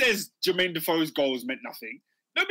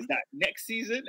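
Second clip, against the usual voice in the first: ordinary talk, nothing marked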